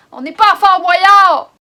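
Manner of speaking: loud, disgusted outburst; exasperated shout. Real, not acted. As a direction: yell it in disgust.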